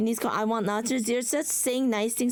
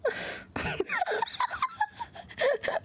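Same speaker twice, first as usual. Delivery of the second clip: 1 high squealing laugh; 4 dB quieter than usual. Real, not acted. Laughing at own joke.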